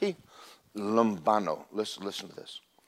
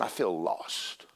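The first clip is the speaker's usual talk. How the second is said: slow, low, hushed despair; a quiet sinking confession; feeling lost; 9 semitones lower, 12 dB quieter, much slower than usual. Retold or imitated. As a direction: drag out the lostness. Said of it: "all of a sudden".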